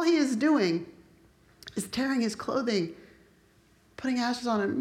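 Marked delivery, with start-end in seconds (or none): none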